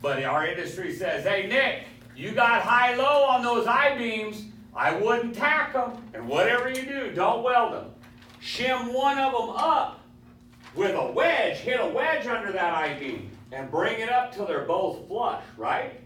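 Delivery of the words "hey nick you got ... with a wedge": higher, louder foreman calling across the shop; foreman giving orders. Retold or imitated. Shop foreman warns Nick off the misaligned I-beams, loud and high. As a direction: holler the warning like a boss on the floor.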